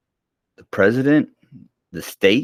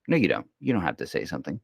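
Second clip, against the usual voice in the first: ordinary talk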